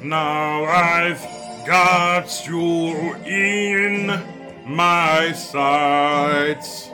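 usual speaking voice

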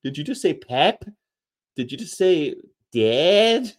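high-pitched incredulous questioning, rising at the end; mock disbelief. Reacting to companion's odd words, mock incredulous. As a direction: query the odd word as if you can't believe it. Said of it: "Certain words, I'd be like".

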